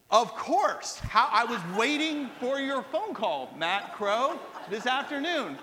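hammed-up, slow sarcastic welcome; mock enthusiasm. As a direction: pretend you were expecting this very call. Mock welcome to an unexpected caller.

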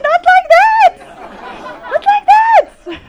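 very high, loud squeal with laughter; mock horrified protest. Wife objecting to his method, squealed mock horror. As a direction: shriek the objection in comic horror. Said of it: "I said".

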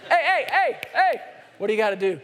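put-on like a parent catching a child staring at something shiny; calling a child back. Parent mid-lecture calling a distracted child back, loud.

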